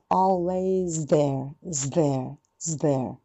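very slow "always there" keeping the z before "there"; slow drill. Shown as a demonstration. Teacher drilling the phrase slowly for students. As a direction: drag "always there" out, hold the z. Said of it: "頑張って".

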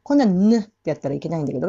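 ordinary delivery

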